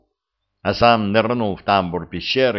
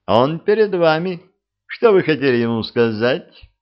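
louder, mocking reveal; taunting; 7 semitones higher, 5 dB louder than usual. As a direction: present him with a sneer. Vladislav confronts the officer. (насмешливо, с вызовом)

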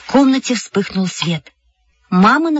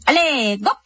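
high, drawn-up circus-style call; playful grand unveiling; showy announcement; 8 semitones higher than usual. A narrator's reading. Girl unveils her piggy showily.

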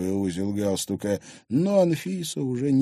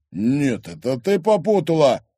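loud, raised menacing warning; threatening; 7 semitones higher, 7 dB louder than usual. Acted. Thug warning girl against joking, threatening. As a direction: threaten her with rough force. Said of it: "угрожающе двинулся к ней".

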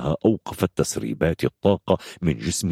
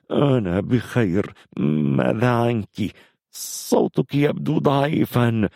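slow, high, strained concern; labored reassurance turning to concern for her; concerned injured hero; 8 semitones higher, slower than usual. Acted. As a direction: stretch the words out with worried effort. Injured hero worries about his friend.

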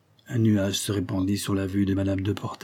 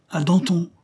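higher, sour little toast; 8 semitones higher, 4 dB louder than usual. Acted. The fussy guest toasts Danton in a sour voice. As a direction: raise the glass with a sour edge. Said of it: "d'une voix aigre".

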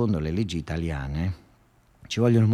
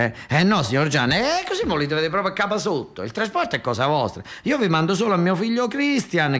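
higher, chatty back-and-forth characters; phone deal voices; 6 semitones higher than usual. Acted. Gianni and Nicola haggling over toxic waste, higher voices. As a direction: play both sides of the call. Acted phone dialogue continues.

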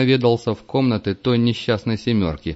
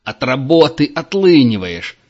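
louder, higher, slow yelling; angry shout; 5 semitones higher, 7 dB louder than usual. A narrator's reading. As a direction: yell the accusation. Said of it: "кричал он". (гневный крик)

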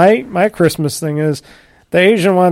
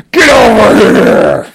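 slow, loud drawn-out battle cry; video game fighter shout; 5 semitones higher, 8 dB louder, slower than usual. Retold or imitated. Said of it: "Mortal Kombat".

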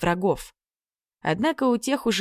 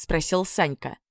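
usual speaking voice

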